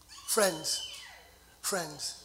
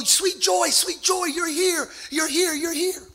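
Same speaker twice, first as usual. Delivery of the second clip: joyful greeting; 6 semitones higher, slower than usual. Retold or imitated. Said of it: "And they go".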